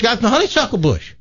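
high-pitched city guy reply. Acted. City guy answering the baffled farmer, high-pitched. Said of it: "He says".

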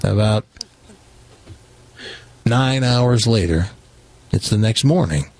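ordinary talk

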